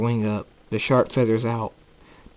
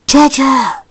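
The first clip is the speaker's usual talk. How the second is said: loud, high-pitched warning; furious shout; 12 dB louder than usual. Acted. Jacob with glowing red eyes, furious shout. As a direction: explode on the words.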